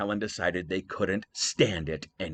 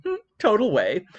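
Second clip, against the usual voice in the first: put-on much higher, playful valley-girl quip; smug Athena; 11 semitones higher, 5 dB louder than usual. Athena answering Hera, playful high quip. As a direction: tease with a knowing grin. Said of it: "And Athena said".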